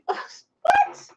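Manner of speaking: very high, rising surprised squeak; surprised what. Real, not acted. Reading a surprising comment, squeaking high.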